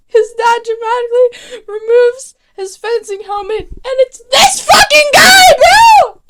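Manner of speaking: shrieking high, rising into a loud shout; shocked excitement. Real, not acted. Vlogger reacting to the villain reveal, screaming high. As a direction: lose it at the twist, voice cracking up high. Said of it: "reveal of Malcolm, hyped".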